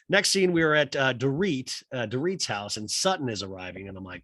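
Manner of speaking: ordinary delivery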